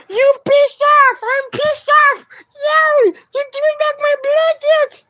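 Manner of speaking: very high, slow, put-on squeal; playful falsetto scolding, stretched out; squeaky mock voice. Streamer teasing the cat over the blanket, squeaky mock voice.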